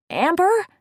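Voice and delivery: high, loud call through the door. A narrator's reading. Worried friend calls to Amber through the door, high loud call. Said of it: "Tasha called".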